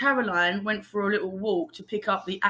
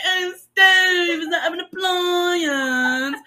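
nutcase outburst; 7 semitones higher, much slower than usual. Retold or imitated. Re-enacting nonsense at the intercom camera, nutcase wail. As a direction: draw it out absurdly, high and silly. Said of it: "just being an absolute nutcase".

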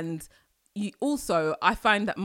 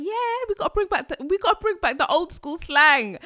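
higher, faster upbeat insistence; playful hype for old slang; hyped quote; 10 semitones higher, 5 dB louder than usual. Retold or imitated. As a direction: pump it up like a rallying cry. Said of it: "I was like".

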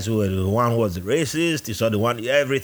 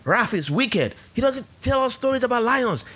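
much higher, childish mock voices; mock recruits; 12 semitones higher than usual. Mimicking fellow recruits praising his stories, much higher and childish. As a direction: play the recruits gushing over the good African. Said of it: "They were like".

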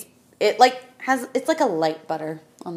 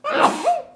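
sharp gasping sneeze; a real sneeze bursting out; 4 dB louder than usual. Real, not acted. Speaker sneezes.

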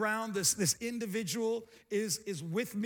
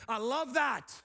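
raised, emphatic exclamation; emphatic delight; 2 semitones higher than usual. Real, not acted. Preacher relishing an already-answered prayer, emphatic delight. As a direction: declare it heartily to the room. Emphatic line, then lower repeat.

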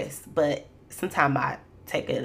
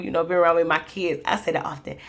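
normal talking voice

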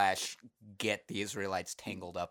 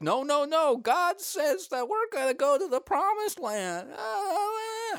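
loud, very high squawking Moses; cracking pubescent voice; 5 dB louder than usual. Acted. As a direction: squeak like a boy whose voice is breaking. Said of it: "What if he's just going through puberty?".

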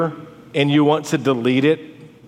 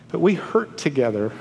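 ordinary delivery

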